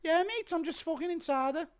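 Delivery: high mock-taunting celebration; a shrill jokey Till bragging; Till impression. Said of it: "Till celebrating his win with Gary's wife".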